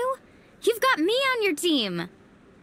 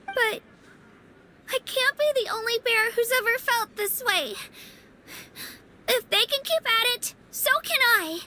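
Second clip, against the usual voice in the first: normal talking voice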